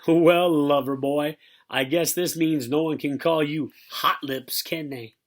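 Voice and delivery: loud, drawn-out ribbing dad; hammed-up fatherly razzing; mocking teasing. A narrator's reading. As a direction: tease the boy with relish. Dad teasing son, acted.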